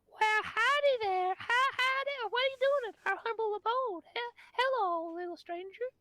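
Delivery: a cheery little mushroom crowd drawling howdy; many tiny voices greeting a stranger; chirpy spore colony. Acted.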